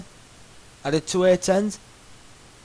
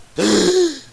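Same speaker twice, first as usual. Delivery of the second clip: mock shocked gasp; 9 semitones higher, 4 dB louder than usual.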